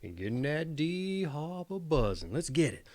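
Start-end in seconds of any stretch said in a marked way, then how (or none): none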